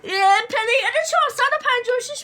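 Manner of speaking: high, loud announcer yell; hammed-up shout. Gamer playing a hyped announcer, shouting. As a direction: bellow it like a game host. Shouting game-show style intro.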